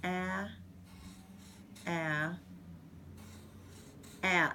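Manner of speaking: ah, ah, ah spaced by long pauses; dictation with spaces. Shown as a demonstration. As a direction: leave clear gaps between each ah. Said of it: "you want them to know where the spaces are".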